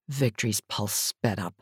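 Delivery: plain reading voice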